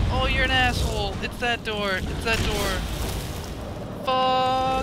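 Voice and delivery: high, urgent frantic yelling; real panic. Real, not acted. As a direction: plead desperately for a way out. Panicking to find the escape door.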